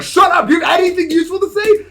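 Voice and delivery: high, loud furious yell, fast at the end; angry shout. Retold or imitated. Yelling at the chatbot that won't stop flattering, angry shout. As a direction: scream it at the machine. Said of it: "I got so mad".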